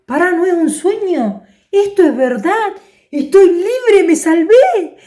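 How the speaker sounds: he suddenly grasps he's free; excited relief. Acted. Pedro realizing he is free, excited and joyful.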